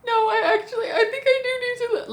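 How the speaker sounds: very high, laughing and crying at once; squeaky laugh-cry parody; laughing mock sob. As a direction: wail it through giggles. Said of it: "Piper was like".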